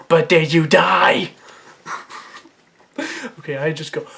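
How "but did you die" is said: slow, louder comic comeback; Mister Chow. Retold or imitated. Dismissing a dinosaur attack, emphatic Mister Chow impression. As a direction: push the question out slowly and loudly. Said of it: "Mister Chow".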